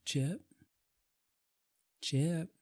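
hushed, trying to wake him; soft call to a sleeping friend; whispering. Acted. Waking sleeping roommate softly.

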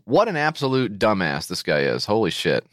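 ordinary delivery

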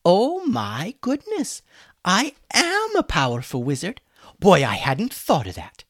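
high, exaggerated fake amazement; mock the suggestion sarcastically; sarcastic gushing. A narrator's reading. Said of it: "mocked Hummus".

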